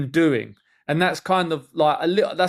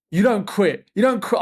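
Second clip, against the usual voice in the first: mock telling-off; 6 semitones higher, 4 dB louder than usual. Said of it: "I was like".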